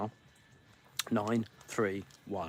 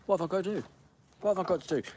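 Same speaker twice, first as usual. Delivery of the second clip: high, pleading whine; exasperated; 7 semitones higher, much faster than usual. Real, not acted. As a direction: throw up your hands in despair. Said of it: "exasperated plea".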